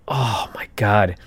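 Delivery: breathy whisper of pain, slow. Retold or imitated. Whispering to himself while hobbling through the mall. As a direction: hush it out like the pain is too much. Pained inner exclamation in mall.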